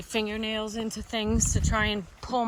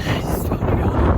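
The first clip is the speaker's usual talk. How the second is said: breathless, voiceless crying; whispered sob; 8 dB louder than usual. Real, not acted. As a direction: sob the words out. Breakdown continues.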